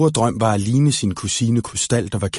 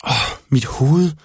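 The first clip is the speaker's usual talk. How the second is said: slow, dazed moan; groaning; slower than usual. Acted. Følfod wakes on the rock, groaning slowly. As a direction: come to with an aching head. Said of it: "stønnede Følfod".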